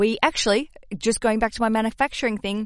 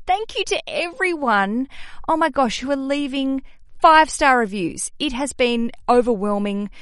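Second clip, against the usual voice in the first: excited gush; 5 semitones higher, 5 dB louder than usual. Real, not acted. Thanking reviewers, gushing.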